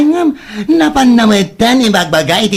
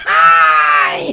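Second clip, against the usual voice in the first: laughing exclamation; 3 semitones lower than usual. Acted. Gossiping friends react.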